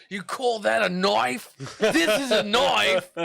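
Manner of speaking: put-on loud, high, broad Aussie accent; hammed-up Australian movie-hero boast; big Australian bravado, stretching the vowels; mock Australian accent. Dundee quote for offended snake.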